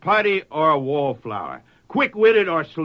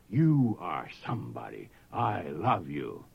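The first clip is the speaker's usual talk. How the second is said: tender voice of God; 8 semitones lower, 8 dB quieter than usual. Acted. Closing words of God.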